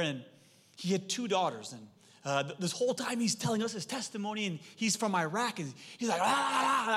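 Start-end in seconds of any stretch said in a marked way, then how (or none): 6.2-7.0 loud, higher fiery exclamation imitating a passionate old man; retold passionate shout; fierce outburst. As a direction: belt out the sounds like an angry stranger. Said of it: "Man, is he angry?".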